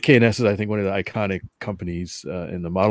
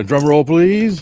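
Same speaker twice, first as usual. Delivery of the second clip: slow, louder drum-roll call; hammy ringmaster build-up; showman announcement; 6 semitones higher, 8 dB louder, much slower than usual. Pretend. Announcing the guest.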